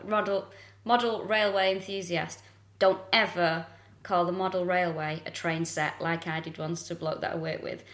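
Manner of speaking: usual speaking voice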